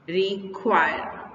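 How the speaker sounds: Require slowly, in two parts, weight on KWIRE; last-syllable stress. Shown as a demonstration. Teacher giving a verb example, require stressed on KWIRE. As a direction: lean on the end of require. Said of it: "last वाले syllable पर".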